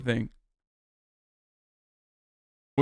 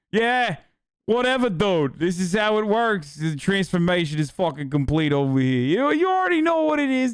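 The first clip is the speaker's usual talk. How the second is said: higher, put-on New Jersey guy; New Jersey accent; 4 semitones higher than usual. Immigrant suddenly assimilated, talking in a New Jersey accent. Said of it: "turn it into a New Jersey accent".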